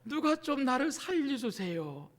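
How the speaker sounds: mock polite, dignified cry for help; restrained, genteel call for rescue; genteel plea. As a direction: plead politely, too composed to raise your voice. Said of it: "좀 고상하게 살아날 걸 예상하면서".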